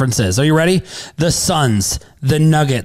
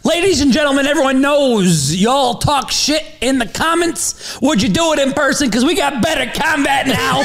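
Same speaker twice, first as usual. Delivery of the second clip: higher, half-shouted announcer voice; hype announcer; 7 semitones higher than usual. Acted. Promoting Better Combat to commenters, as a hyped announcer. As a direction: pump up the crowd like a fight promoter.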